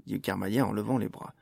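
ordinary delivery, nothing marked

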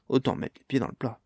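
ordinary delivery

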